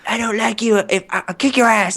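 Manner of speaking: high-pitched mock scolding, imitating her; mock telling-off. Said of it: "Like, really chewed me out. She was like".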